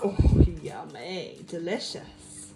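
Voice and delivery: slow, drawn-out pretend relish; playful mock delight. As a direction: pretend to savour a toy toast. Mock praise to toddler.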